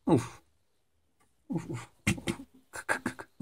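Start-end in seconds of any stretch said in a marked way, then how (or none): 1.5-3.3 breathy huffs copying the dog; imitated panting. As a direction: huff at the dog playfully.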